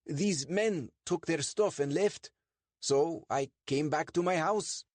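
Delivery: high, over-eager innocent voice; nervous Sandeep. Acted. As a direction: talk your way past the police, a touch too high. Sandeep continues his alibi.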